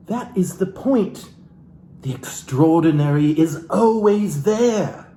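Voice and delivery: lightly theatrical book reading; a hint of stage reading from the book; dramatic reading. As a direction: read the passage like a soulful memoir. Continues reading the book aloud.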